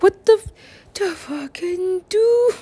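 high voice slowing into a drawn-out, baffled curse; mock bafflement. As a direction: act baffled, stretching the words up high. Acted bafflement at aliens' order.

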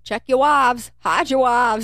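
loud, high mock alarm to the town; hammed-up shouted warning. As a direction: sound the alarm like a town crier. Acting a warning to husbands.

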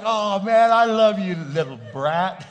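loud, laughing mock gruff; mock scolding. Grandpa answering teasing granddaughter, loud mock gruff. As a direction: bellow it with affection. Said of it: "I'd be like".

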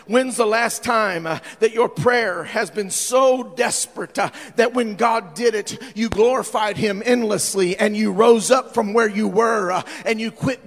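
loud, ringing sermon delivery; full-force preaching. Acted. Pastor preaching loudly to the congregation. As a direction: preach it out to the whole church.

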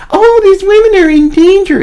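high, whiny mock complaint; mock whining. Mocking women who say they are in danger, whining high. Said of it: "the whining and the griping".

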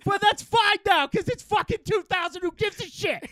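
hammed-up Bill Burr ranting. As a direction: rant like a parody Bill Burr. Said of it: "I'll do ranting at women Bill Burr".

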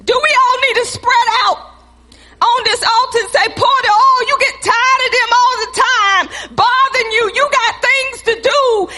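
high, loud, driving pulpit cry; preaching at full force. Acted. Pastor calling the church to the altar, at full preaching force. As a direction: lift it up and preach hard at them.

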